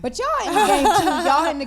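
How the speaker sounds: laughing. Real, not acted. Laughing teasing reply to friends.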